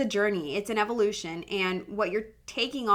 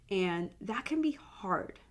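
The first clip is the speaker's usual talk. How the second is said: whispered, slow and emotional; tearful whisper; 6 dB quieter, slower than usual. Real, not acted. Mom admitting the weight to listeners, tearful whisper. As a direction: breathe it out, barely voiced. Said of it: "I'm getting emotional".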